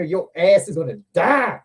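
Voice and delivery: usual speaking voice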